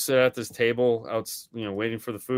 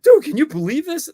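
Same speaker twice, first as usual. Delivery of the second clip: high, loud retold disbelief; mock amazed squeal; imitated amazement; 7 dB louder than usual. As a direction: act out her astonished reaction. Retold amazed reaction to video.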